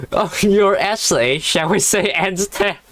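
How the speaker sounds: high, laughing read-aloud of a sarcastic line; amused, giggly sarcasm; sarcastic, through laughter. A narrator's reading. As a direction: read it while cracking up. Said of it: "He said it in his very sarcastic voice".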